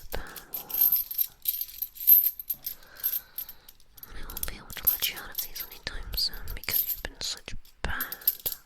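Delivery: hushed, teasing disciplinarian; stern whispered scolding. Pretend. Streamer scolds a bad wolf for misbehaving, whispered stern role-play. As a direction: scold the naughty wolf in a breath-only murmur.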